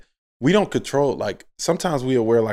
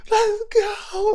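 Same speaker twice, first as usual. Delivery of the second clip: high, strained mock yell; retold yell. Player imitating his bench yelling at scorer's table, mock yelling. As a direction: imitate courtside yelling without full volume. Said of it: "Like, yelling, blah, blah".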